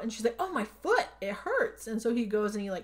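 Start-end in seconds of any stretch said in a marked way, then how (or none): 0.4-1.9 mildly imitated cry of pain, high at first; pained cry, retold. She cries out over her phantom limb, mock pained cry. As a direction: lightly act out crying out in pain. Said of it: "then she cries out".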